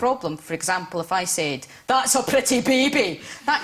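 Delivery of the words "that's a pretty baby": aggressive Scottish accent. Shown as a demonstration. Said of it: "Scottish people can sound quite aggressive".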